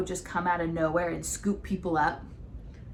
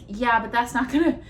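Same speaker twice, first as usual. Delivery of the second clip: higher, louder laughing objection; laughing incredulity; 6 semitones higher, 7 dB louder than usual. Acted. Arguing with the show's plot to co-host, laughing disbelief. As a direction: protest the absurdity, breaking into laughter.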